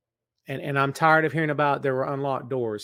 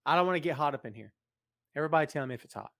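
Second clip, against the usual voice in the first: ordinary delivery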